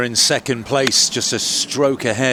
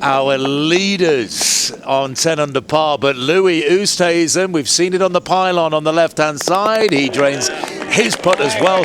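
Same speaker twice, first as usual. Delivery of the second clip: golf commentator; 5 semitones higher than usual. Acted. Live play-by-play.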